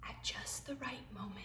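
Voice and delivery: whispered, holding the breath; hushed storybook suspense. A narrator's reading. Suspense before the jump.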